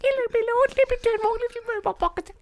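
very high falsetto, flustered; Petunia voice. Acted. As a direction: squeal it out like a panicked maid. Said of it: "Now I'm Petunia".

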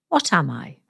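ordinary delivery

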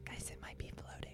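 soft breathy whisper, no voice. Shown as a demonstration. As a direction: whisper it close to the mic. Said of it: "I'm not supposed to whisper into the microphone".

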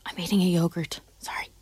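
whispered, soft apology; hushed aside. Real, not acted. Co-host excusing her yogurt mid-reveal, hushed aside. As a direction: whisper it under the tension. Aside while waiting for the result.

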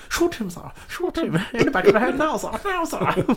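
high-pitched, urgent mock plea; hammed-up high voice urging "shoot him"; mock pleading soldier. Acting out a film scene.

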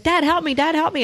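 high-pitched mock plea to dad; retold high plea for help; pleading daughter. Daughter begging dad while fishing.

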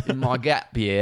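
lightly put-on Australian voice; attempted Australian accent. Host echoing her co-host's line, attempted Australian accent. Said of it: "do an Australian accent".

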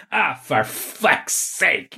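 long, drawn-out exasperated groan of a curse; retold exasperated curse. Teenage cleaner to customer who vomited on him, drawn-out exasperated curse.

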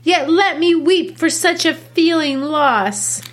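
teacher reading Juliet, lightly plaintive. Acted. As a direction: plead lightly for your grief. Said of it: "I'll read Juliet".

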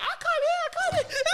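squeaky laughing cry of panic; high mock panic. Kid at first finding it fun, squealing. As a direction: yelp it high, half laughing. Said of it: "it's like".

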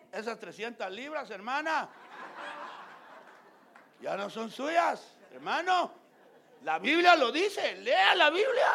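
loud, driving sermon voice, hammering each command; preaching at full force. Acted. Pastor exhorting the congregation, loud fervent preaching. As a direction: preach it hard from the pulpit. Preaches loudly about marital debt.

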